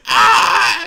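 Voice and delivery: loud high scream. Acted. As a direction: shriek as the hand is pulled in. Hand being sucked in.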